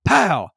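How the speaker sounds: loud playful gunshot sound; mock gunshot. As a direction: imitate the gunshot. Imitating the gunshot.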